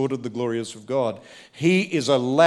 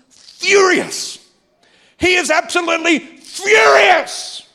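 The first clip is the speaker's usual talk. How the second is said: much higher, much louder shout on absolutely furious; 9 dB louder, slower than usual. Real, not acted. Preacher telling the congregation of the brother's rage, shouting. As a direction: cry it out like the anger itself.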